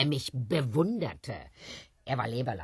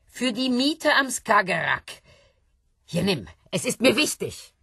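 loud, high, pressing the money on him; insist, almost pleading; pushy and excited; 8 semitones higher, 6 dB louder than usual. Acted. Woman forcing the money on John, loud and high.

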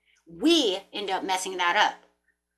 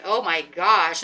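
dismayed; 3 semitones lower, 5 dB louder, much slower than usual. Retold or imitated. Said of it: "you're like".

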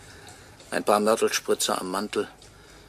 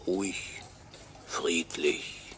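soft mocking whisper; 2 semitones lower, 6 dB quieter, much slower than usual. Ghost mocks the honoured widow.

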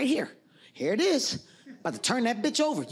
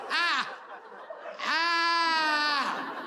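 panicked cry; 6 semitones higher than usual. Retold or imitated. Boxer blinded by a punch, panicked yelling cry. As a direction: cry out like you've suddenly gone blind.

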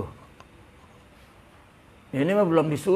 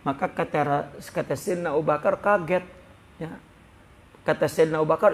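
normal talking voice

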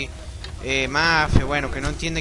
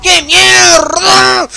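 furious shout; 16 dB louder, much slower than usual. Real, not acted. Dying in game, raging.

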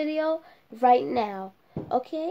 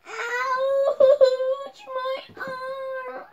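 wailing in pain; much slower than usual. Pretend. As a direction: sob out the hurt like a little child. Doll breaks her arm.

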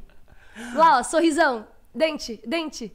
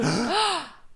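scared gasp; 2 semitones higher than usual. Shown as a demonstration. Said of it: "Olha, assustado".

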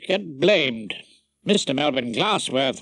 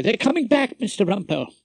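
a hurried, slightly raised summons; urgent; 7 semitones higher, faster than usual. A narrator's reading. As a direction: hurry the barrister back to court. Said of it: "he said urgently".

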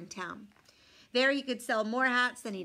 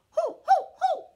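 very high, loud hooting; monkey hoots; 11 dB louder than usual. A narrator's reading. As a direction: hoot back like cheeky monkeys.

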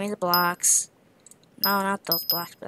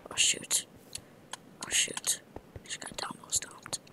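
soft whispered oh shoot, deflated; whispered dismay; 9 dB quieter than usual. Real, not acted. Gamer whispering to himself after getting stomped. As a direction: breathe the words out, crushed.

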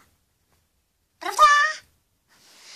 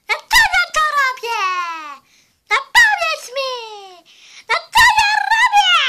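rising from quick demand to laughing yell; playful squeal building to a shout; 7 semitones higher, 3 dB louder, much faster than usual. Pretend. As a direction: push it up into a shrieking giggle. Toy demanding answer, escalating.

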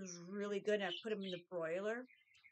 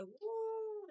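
happy whoop; 11 semitones higher than usual. Retold or imitated. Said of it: "he's like".

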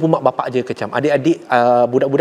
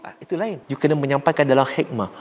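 normal talking voice

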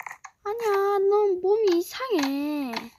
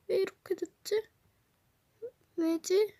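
scared whisper; 3 semitones higher, 6 dB quieter than usual. Acted. 놀라 속삭임.

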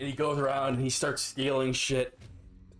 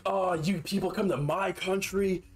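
higher, mocking rant of an indignant thief; 6 semitones higher than usual. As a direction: sneer it out as the outraged shoplifter. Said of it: "Just like".

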